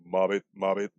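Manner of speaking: the papa frog croaking his word. Pretend. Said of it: "Papa Frog goes".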